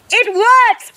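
thrilled duck shouting high; excited cry. A narrator's reading. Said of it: "Quentin cried".

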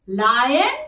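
ordinary talk

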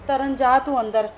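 loud command to go inside; mother ordering daughter inside, loud; sharp order. Acted. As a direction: send her off loudly.

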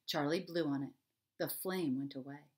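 usual speaking voice

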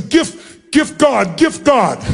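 high-pitched, halting exclamation in broken English; imitated overjoyed woman. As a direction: act out a grateful woman struggling for English words.

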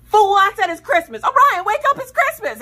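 loud mock yell. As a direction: yell him awake, half-joking. Mom yelling to wake son.

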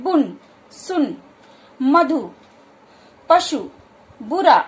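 बुन, सुन said slowly, then मधु, पशु, बुरा one by one with a short उ; short u recap. Shown as a demonstration. Teacher repeating the example words with a short u. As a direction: list बुन, सुन, मधु slowly but keep each उ brief. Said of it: "थोड़े समय के लिए हो रहा है".

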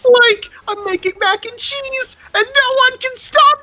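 very high, cracking cartoon slacker voice; Shaggy. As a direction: build to a loud, high-pitched cry. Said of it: "Can [name] in his shaggy voice say".

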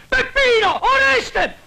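loud shout for his man; calling out. Acted. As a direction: yell across the room for help. Shouting for help in his shop. (grido per chiamare aiuto)

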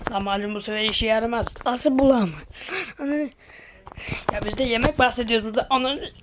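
normal talking voice

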